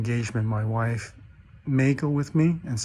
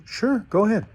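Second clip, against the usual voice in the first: much higher, sly sing-song okay; knowing sly agreement; 10 semitones higher than usual. Acted. Older priests letting the rookie try, sly and high. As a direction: agree as if in on a joke.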